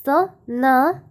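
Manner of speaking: usual speaking voice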